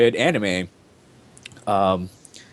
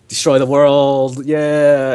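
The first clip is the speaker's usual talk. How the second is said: drawn-out, louder hero's cry, higher; hammed-up, stretched-out cheer; mock heroic; 5 semitones higher, 5 dB louder, much slower than usual. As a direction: parody a cartoon hero rallying friends. Mocks a simple hero storyline.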